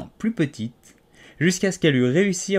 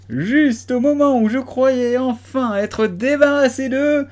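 very high, much louder, slow piercing cry; shrill shriek; 14 semitones higher, 8 dB louder, slower than usual. A narrator's reading. Pigeon shrieking at the snake, high piercing cry. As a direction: let it climb into a screech. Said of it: "la voix monta jusqu'à devenir un cri aigu".

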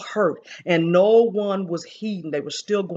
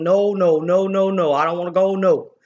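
hollering; 5 dB louder than usual. Retold or imitated. Woman retelling her vision of being taken into fire, mock hollering. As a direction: holler it out in mild imitation of pleading. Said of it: "I was hollering".

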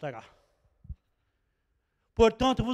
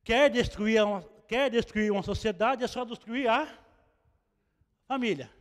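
ordinary delivery

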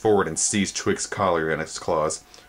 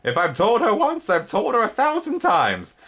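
high, louder exasperated insect voice; 4 dB louder than usual. A narrator's reading. Spindlebug exasperated at her upstairs, high voice. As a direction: sigh it out, fed up.

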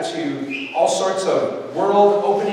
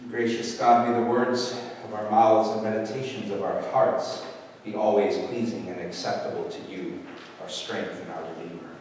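soft, lower, reverent prayer voice; 7 semitones lower, 7 dB quieter than usual. Acted. Pastor leading the congregation in prayer, soft and reverent. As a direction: pray it quietly before the sermon.

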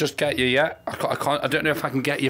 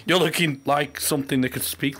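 ordinary talk

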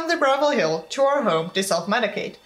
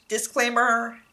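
plain reading voice

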